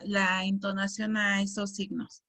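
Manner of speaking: ordinary delivery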